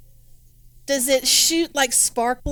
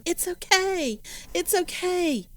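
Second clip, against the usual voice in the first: higher, soothing reassurance; comforting; 6 semitones higher than usual. Acted. Player comforts the screaming Fanny about Polly, soothing higher voice. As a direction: soothe her as you would a frightened child. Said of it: "putting my arms around her going".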